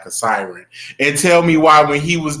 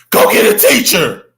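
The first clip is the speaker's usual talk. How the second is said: shouted quote; 5 semitones higher, 8 dB louder than usual. Retold or imitated. Quoting the viral fight video.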